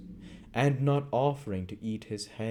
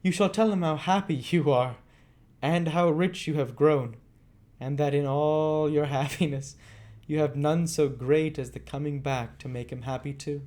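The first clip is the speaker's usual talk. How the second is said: put-on higher young lady's voice, a little laugh in it; Rose, warm and glad; 8 semitones higher, 5 dB louder than usual. Rose cheering Oliver about Dick, higher and warm. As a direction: reassure him with gladness.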